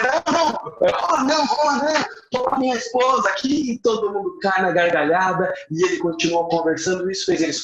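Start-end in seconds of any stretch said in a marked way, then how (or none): 0.0-3.7 high, rushed flustered denial; flustered high protest. Retold or imitated. Friend hastily correcting the drivers, flustered and high. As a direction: blurt it out, caught off guard.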